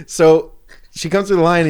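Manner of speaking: ordinary talk, nothing marked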